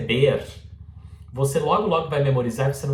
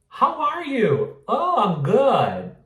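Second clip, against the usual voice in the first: high, slow sing-song exchange; example dialogue; 10 semitones higher, 3 dB louder, much slower than usual. Acted. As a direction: act out a greeting and its reply, pitched up. (diálogo encenado, agudo e devagar)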